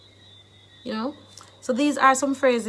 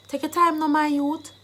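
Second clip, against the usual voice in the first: higher Jamaican Patois urging; 4 semitones higher than usual. Shown as a demonstration. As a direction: urge a youth on in Jamaican Patois. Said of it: "I've given you the Jamaican dialect".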